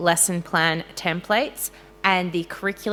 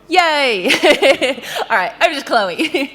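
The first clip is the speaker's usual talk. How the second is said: high, loud cheer breaking into laughter; excited laughing; 7 semitones higher, 7 dB louder than usual. Real, not acted. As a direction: whoop then laugh through the handover. Cheering and laughing handing over.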